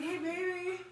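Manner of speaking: high, drawn-out mock sweet-talk; teasing imitation. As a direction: imitate a partner wheedling for help. Teasing imitation of partner asking help.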